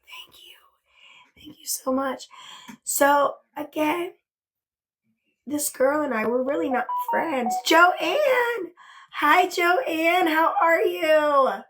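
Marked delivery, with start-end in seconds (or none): 0.1-2.3 breathy, hushed thank-you; softly whispered gratitude. Real, not acted. Touched thanks after gift. 7.7-11.6 high, loud, drawn-out hello; thrilled, sing-out welcome; excited greeting. Real, not acted. Greets a viewer joining.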